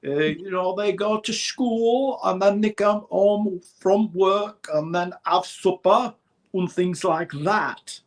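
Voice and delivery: a light Yorkshire impression; Yorkshire accent. Showing the host Python's Yorkshire folk, Yorkshire accent. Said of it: "the Yorkshire accent".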